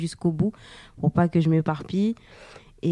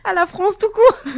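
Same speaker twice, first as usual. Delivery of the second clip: laughing; 7 dB louder than usual. Real, not acted. Laughing while answering about France.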